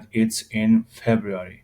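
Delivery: جمله را آهسته و شمرده بخوان، It's in February; slow model reading. Shown as a demonstration. (تلفظ آهسته برای شاگردان)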